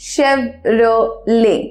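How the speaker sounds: Chevrolet slowly as "shev... ro... lay"; syllable-by-syllable pronunciation. Shown as a demonstration. Teacher breaking Chevrolet down, syllable by syllable. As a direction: split Chevrolet in three, silent T. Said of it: "it's actually Chevrolet".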